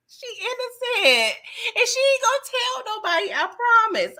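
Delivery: high, pleading voice. Retold or imitated. Vouching plea for the lady.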